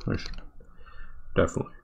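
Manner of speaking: whispered, quick and hushed; mock whisper. JP whispering praise of the base, quick hushed whisper. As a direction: hush it out fast like a secret. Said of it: "J P was like".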